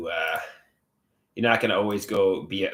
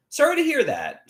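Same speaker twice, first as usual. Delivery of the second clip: high sympathy; 3 dB louder than usual. Real, not acted. Sympathy for sick viewer.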